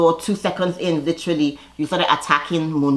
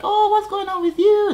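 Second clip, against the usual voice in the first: mocking high voice. [name]'s voiced line, high squeal.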